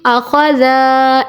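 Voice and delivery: "आखौज़ा" slow, final vowel dragged long; wrongly stretched. Shown as a demonstration. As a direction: show the mistake by drawing out "आखौज़ा". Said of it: "ऐसे नहीं पढ़ना".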